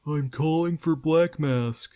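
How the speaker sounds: slow, loud, hammed-up goon voice; phony zombie voice. A narrator's reading. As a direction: play a clumsy tough guy. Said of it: "in a phony zombie voice".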